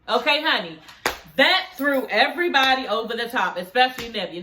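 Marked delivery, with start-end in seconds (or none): none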